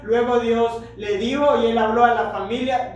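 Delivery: ordinary talk, nothing marked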